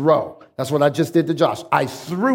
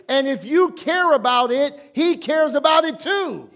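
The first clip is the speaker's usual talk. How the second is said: emphatic preaching; 7 semitones higher than usual. Real, not acted. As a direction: preach it with rising insistence.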